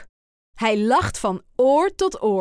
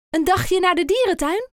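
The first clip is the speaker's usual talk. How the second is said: higher, eager guess; higher hopeful ask; hopeful question; 8 semitones higher than usual. A narrator's reading. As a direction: pitch it up with hope. Aap asks hopeful question.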